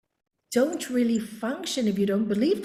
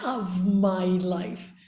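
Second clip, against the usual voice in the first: very slow, drawn-out "love my life"; demonstrated affirmation; 2 semitones lower, much slower than usual. Retold or imitated. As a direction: stretch the affirmation out slowly.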